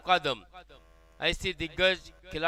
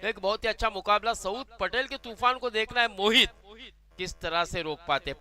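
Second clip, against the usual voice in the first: ordinary delivery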